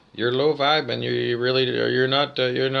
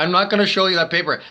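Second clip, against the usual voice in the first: fast, higher, louder refusal, a hint of a cornered kid; defensive student; 5 semitones higher, 4 dB louder, faster than usual. Student refusing to show the gang his test paper, defensive and quick. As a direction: snap back quickly, guarding the bad test.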